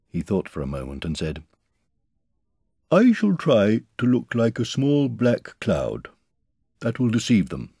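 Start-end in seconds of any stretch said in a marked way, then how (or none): 2.9-7.7 high, slow storybook bear; deliberate, raised character voice; Pooh planning solemnly. A narrator's reading.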